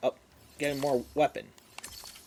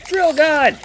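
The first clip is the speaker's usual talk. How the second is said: shouting; 9 semitones higher, 6 dB louder, much slower than usual. Real, not acted. Shouting while firing.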